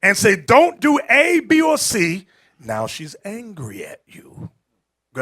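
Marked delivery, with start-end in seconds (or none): 0.4-2.3 stern command, louder and higher; preacher's raised rebuke. Retold or imitated. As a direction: scold her slowly with a raised voice. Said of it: "raise your voice at her".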